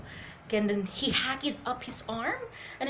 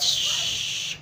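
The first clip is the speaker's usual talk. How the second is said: loud unvoiced "shhh" sound effect; long hissed swoosh; whooshing impact noise; 3 dB louder than usual. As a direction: imitate a fist rushing down. Imitates fist swinging down.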